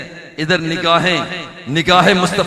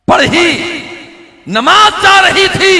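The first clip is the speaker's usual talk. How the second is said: raised preaching voice; 11 semitones higher, 5 dB louder than usual. Acted. Preacher's dramatic narration peak.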